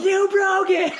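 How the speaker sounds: high-pitched mock child's screech; imitated screaming kid. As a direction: squeal it like an outraged little boy. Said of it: "first words out of the kid's mouth".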